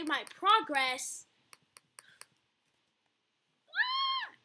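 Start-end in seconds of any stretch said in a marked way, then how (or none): 3.7-4.3 very high-pitched shriek; startled scream. Real, not acted. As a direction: scream in fright at a jump scare. Startled during scary game.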